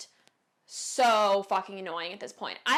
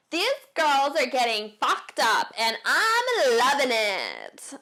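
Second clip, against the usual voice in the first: loud, very slow exaggerated Valley Girl; 4 semitones higher, 6 dB louder, slower than usual. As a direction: drag it out like a party girl. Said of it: "like, Valley Girl".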